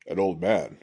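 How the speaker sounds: put-on deep voice, questioning. A narrator's reading. Ronin's brother questions the prince in a deep voice. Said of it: "asked in a deep voice".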